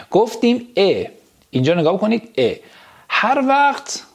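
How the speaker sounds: ordinary talk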